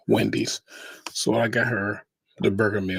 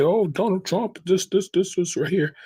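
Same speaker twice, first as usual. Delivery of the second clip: high, mocking voice of the critics; mocking quote; 9 semitones higher, 3 dB louder than usual. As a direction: lift the voice high to mimic what they say. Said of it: "and they say".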